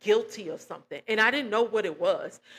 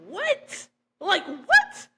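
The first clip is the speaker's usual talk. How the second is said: high, shocked What, then louder Like, what; disbelieving what, pitched way up; stunned disbelief; 10 semitones higher, 5 dB louder, much slower than usual. Retold or imitated. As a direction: react as if you can't believe your ears. Stunned by mother's accusation.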